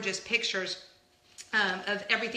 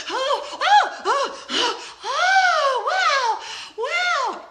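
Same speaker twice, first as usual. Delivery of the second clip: high, loud gasping oohs with laughter; imitating the ride; 10 dB louder, much slower than usual. Vlogger showing Joe Rohde her ride reaction, gasping. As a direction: gasp and coo in awe. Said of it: "because I'm like".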